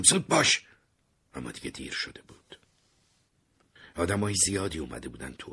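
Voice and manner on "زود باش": high, loud desperate yell; panicked loud shout. A narrator's reading. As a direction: yell hurry up. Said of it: "داد زدم".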